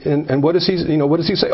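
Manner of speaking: normal talking voice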